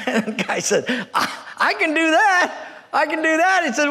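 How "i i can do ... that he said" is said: high-pitched mock excitement; eager. New hire accepts worrying job, eagerly excited. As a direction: jump at it, pitched well up. Job seeker jumps at the offer.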